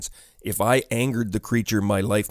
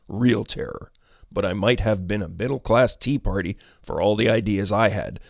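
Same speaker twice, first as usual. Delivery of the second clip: plain reading voice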